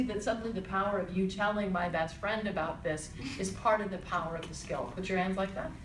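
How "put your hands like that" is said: ordinary talk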